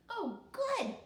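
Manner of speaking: high, glad little voice; a happy kid's quick reply; pleased child. A narrator's reading.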